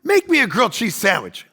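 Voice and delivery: a mock-rude kid ordering his mom around; lightly hammed bratty demand; bossy kid. Kid bossing his mom for a grilled cheese, mock rude. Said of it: "don't be a".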